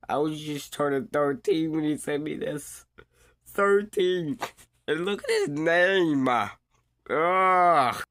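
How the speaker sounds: high, loud, appalled; disgusted outrage. Real, not acted. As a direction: shout it in disgust. Outraged at a creepy message.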